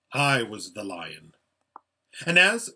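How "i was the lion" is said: low, grave revelation; solemn, majestic lion voice; great lion. A narrator's reading. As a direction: reveal it deeply and simply.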